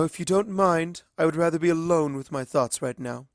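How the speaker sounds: high regal princess voice, firm; lightly voiced royal, closing the subject; Luna. A narrator's reading. Luna dismissing Twilight, firm and regal.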